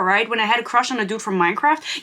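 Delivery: ordinary talk, nothing marked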